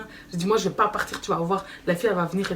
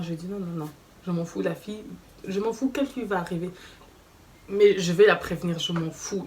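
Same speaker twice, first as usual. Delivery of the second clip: normal talking voice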